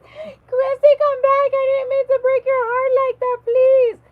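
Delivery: very high, loud begging voice; mock pleading. [name] begging after she walked away, mock pleading.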